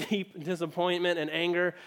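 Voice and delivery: usual speaking voice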